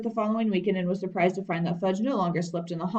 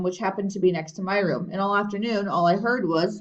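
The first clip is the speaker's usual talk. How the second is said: plain reading voice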